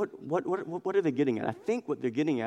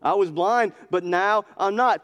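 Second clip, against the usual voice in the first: I was blind, but now I'm not said higher and louder; raised, ringing restatement; emphatic; 7 semitones higher, 5 dB louder than usual. Shown as a demonstration. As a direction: proclaim it boldly. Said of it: "That's all you need to know".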